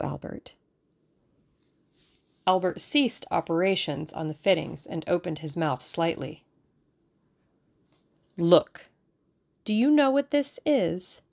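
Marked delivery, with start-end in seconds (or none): 0.0-0.5 breathy whispered name; whispering. A narrator's reading. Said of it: "dropped her voice". 8.4-11.2 dramatic. A narrator's reading. Dramatic reveal of badge.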